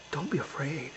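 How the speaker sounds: very soft, low reassurance; gentle voice. A narrator's reading. God comforts the frightened old man, gentle and soft. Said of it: "he heard this gentle voice".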